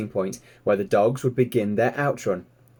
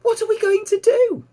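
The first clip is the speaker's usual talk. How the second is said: very high, louder little pig voice; eager piglet; 6 dB louder than usual. Acted. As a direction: squeak it out like a curious piglet. Piglet Babe keeps asking.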